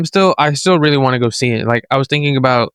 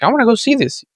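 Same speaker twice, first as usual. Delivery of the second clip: higher-pitched excited reaction; retold excitement; 9 semitones higher than usual. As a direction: quote your own eager reaction, pitched up. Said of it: "I haven't been excited for Marvel".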